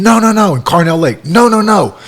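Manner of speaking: mock players objecting; retold protest.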